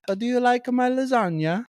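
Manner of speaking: very high mock Italian accent; little old Italian woman. Old Italian cook asking guests about her lasagna, high Italian accent. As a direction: squeak it out in a bad Italian accent. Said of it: "So the little old woman".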